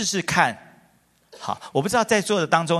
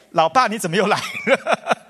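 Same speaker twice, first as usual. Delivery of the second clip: surprised laugh; 5 semitones higher, 4 dB louder than usual. Retold or imitated. Son surprised at father.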